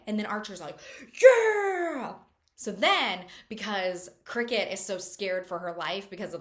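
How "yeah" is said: high, loud triumphant yeah; excited yell. Retold or imitated. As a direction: whoop it out in triumph. Sheriff cheering the find.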